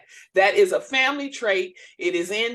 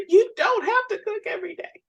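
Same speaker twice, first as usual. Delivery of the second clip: much higher, teary mock whine; mock weepy; 12 semitones higher, 3 dB louder than usual. Wife repeating husband's kind words, mock teary. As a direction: pretend to be moved to tears. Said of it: "he has told me".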